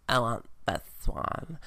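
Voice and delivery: hammed-up stripper picking out clothes; botched stripper impression; attempted stripper voice. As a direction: put on a stripper character voice. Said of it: "when I tried to do my stripper voice".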